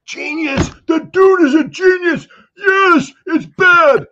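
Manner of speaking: very high, loud hammed-up raving; mock awestruck squeal. American bowled over by a British accent, mock awestruck squeal. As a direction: squeal in exaggerated gullible awe.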